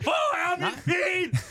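very high, drawn-out imitation holler; hammed-up high wail of a shout; mock shouting. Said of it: "Han gik og råbte".

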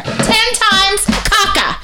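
very high, punched two-part chant; demonstrative shrieking. Retold or imitated. Getting through to non-English-speaking parents, high mock shrieking. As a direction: hammer each word up high, acting it out. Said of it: "So I start going like this".

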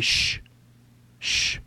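long "shh" hisses. Acted. Podcaster hushing before warning about eavesdroppers, insistent shush. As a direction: hush them with drawn-out shushes. Hushing himself or someone nearby.

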